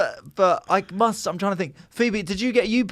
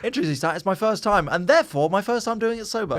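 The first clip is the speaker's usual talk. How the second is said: mock voice; 6 semitones higher, faster than usual. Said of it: "mocking the man's logic".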